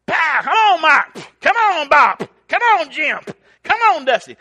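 very high, very loud hollering at a mule; calling the mule. Retold or imitated. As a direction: drive the mule on with big shouts. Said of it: "called him four different names".

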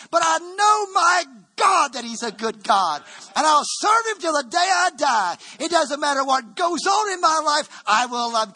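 high, loud preacher's cry over music; full-force preaching shout. Acted. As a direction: shout it to the rafters like a revival preacher.

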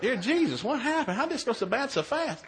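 high-pitched pleading to Jesus; hammed-up high, panicky prayer; acted alarmed prayer. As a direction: plead in comic dismay. Acts alarmed prayer in bed.

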